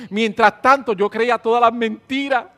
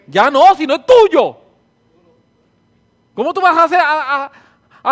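loud and firm, bearing down on tuyo; emphatic loud insistence; 7 semitones higher, 10 dB louder than usual. Real, not acted. Preacher to congregation, loud firm insistence. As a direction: drive it home to the congregation.

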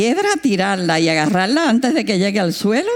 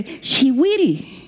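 missionary explaining; 5 semitones higher, much slower than usual. Retold or imitated. Missionary voiced in story.

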